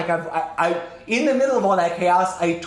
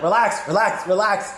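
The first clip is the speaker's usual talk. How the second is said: insistent settling; 4 semitones higher, 3 dB louder than usual. Retold or imitated. Commander quieting panicked crew.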